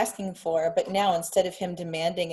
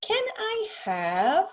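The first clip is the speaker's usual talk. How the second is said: very slow, much higher sing-song "Can I have?"; child echoing tune; 12 semitones higher, much slower than usual. Retold or imitated. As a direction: stretch it out like a toddler copying mom. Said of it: "he says".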